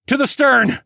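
loud high shout; yelling to his son to run aft; yelled order. A narrator's reading. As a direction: bellow the order over the storm. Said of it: "I yelled".

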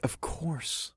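soft, hushed reassurance; gentle whisper. A narrator's reading. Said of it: "I said gently".

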